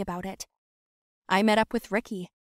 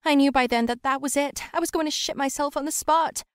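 ordinary delivery

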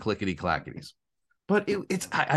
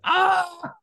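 high, loud imitated cackle; mock shriek of laughter; 5 dB louder than usual. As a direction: shriek like friends dying laughing. Imitating friends dying laughing at him.

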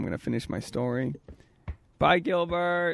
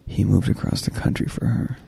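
louder, deeper, pushing the point; loud for the mic; 6 semitones lower, 4 dB louder than usual. Pretend. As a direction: turn it up to be heard. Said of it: "have to turn the volume up".

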